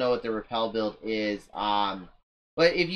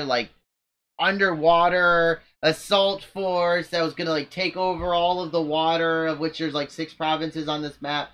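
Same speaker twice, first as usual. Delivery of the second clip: normal talking voice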